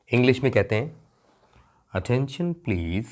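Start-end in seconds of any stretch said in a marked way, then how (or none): 1.9-3.1 slow, measured airport announcer; lightly voiced flight announcement with spaced-out phrases. Teacher imitating an airport announcer for students, measured announcement. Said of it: "बड़ी सुरीली आवाज़ में announcement होता है".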